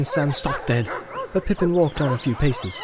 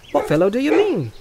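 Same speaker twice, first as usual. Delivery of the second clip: high-pitched puzzled question; curious young hobbit Pippin; 10 semitones higher, 3 dB louder than usual. Acted. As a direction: ask with light, lifted curiosity.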